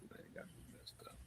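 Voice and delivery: hushed whisper of dismay; whispered despair. Real, not acted. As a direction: whisper it as if broken by losses. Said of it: "I'm just crying".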